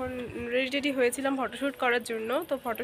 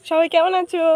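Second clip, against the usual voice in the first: excited; 6 semitones higher, 15 dB louder than usual. Shown as a demonstration. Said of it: "বলো".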